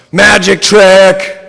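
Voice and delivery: slow, high, very loud shout. A narrator's reading. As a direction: shriek it out drawn long.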